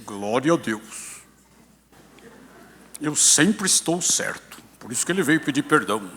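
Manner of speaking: mock the smug one who is always right; imitating a self-righteous believer. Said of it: "você falar assim".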